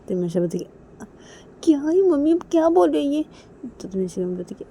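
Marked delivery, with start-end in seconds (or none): 1.6-3.2 loud, high-pitched complaint; exasperated. Acted. Manisha complaining about what mummy says, exasperated. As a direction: protest in a raised, put-upon voice.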